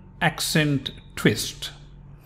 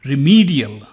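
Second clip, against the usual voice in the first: remedial with a louder, stretched ME; stressed middle E; 5 dB louder than usual. Shown as a demonstration. Teacher pointing out the stress, remedial heavy on the middle E. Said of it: "the middle E is stressed".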